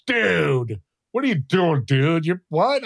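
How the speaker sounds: imitating a stunned young clerk; incredulous, drawn-out "dude" in mild disbelief; freaked out. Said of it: "He was, like, all freaked out".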